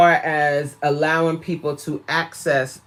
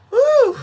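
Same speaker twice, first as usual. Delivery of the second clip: high loud woo; 8 dB louder than usual. Real, not acted. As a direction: whoop way up high. Exclaiming about overthinking.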